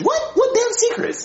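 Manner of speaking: baffled. Acted. Cook baffled by the kids' theory, high incredulous yelp. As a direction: squeak out disbelief.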